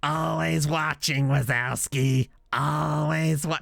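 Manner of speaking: very slow strained Roz voice; Roz from Monsters Inc. Shown as a demonstration. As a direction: drag each word out like the slug receptionist. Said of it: "the one that's like".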